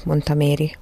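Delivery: usual speaking voice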